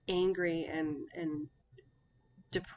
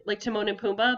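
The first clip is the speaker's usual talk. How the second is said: excited; 4 semitones higher, 10 dB louder than usual. Real, not acted. Excited correction of the name.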